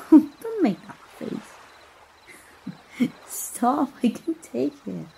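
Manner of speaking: giggling through the words, pitch up; can't stop laughing at his silly face; teasing laugh-talk, barely holding it together. Acted. Princess laughing at stranger's face.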